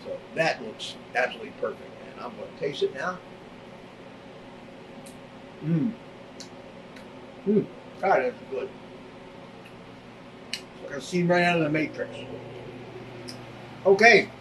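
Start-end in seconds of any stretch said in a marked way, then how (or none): 5.6-14.3 talking through a mouthful of steak; words round a big bite of food; cheeks stuffed full. Real, not acted. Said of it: "Shouldn't talk with my mouth full".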